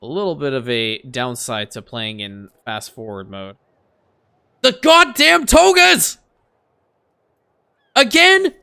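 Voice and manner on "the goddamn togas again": high, loud, drawn-out yell of disbelief; exasperated shout. Real, not acted. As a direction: yell it at the screen, fed up. Exasperated gamer yells at togas.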